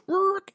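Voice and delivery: high-pitched screech noise; imitated tire screech. Storyteller imitating the van pulling up, high screech. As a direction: squeal like a van swerving in. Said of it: "the van just goes".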